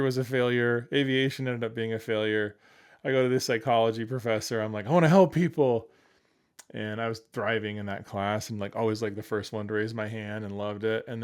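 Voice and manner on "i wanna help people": high, very loud mock enthusiasm; eager declaration. Student telling psychology professor his wish, eager outburst. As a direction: burst out with keen idealism.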